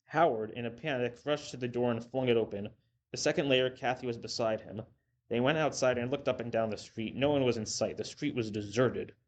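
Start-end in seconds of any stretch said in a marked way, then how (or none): none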